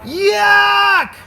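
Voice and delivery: high, loud yell of disgust. Acted. Father tasting the clay cookie, high disgusted yell. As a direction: spit it out with a yell. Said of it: "And he yelled".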